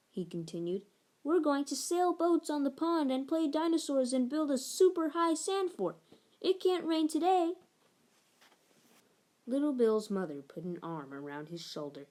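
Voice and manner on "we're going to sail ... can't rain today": put-on insistent child. A narrator's reading.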